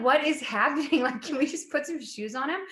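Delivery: high, incredulous protest with a laugh; retold exasperation. Bride-to-be replays protesting the barefoot photo shoot, amused exasperation.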